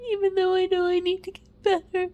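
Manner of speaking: real crying, words tumbling out; snotty crying. Real, not acted. Breaks down crying about getting help.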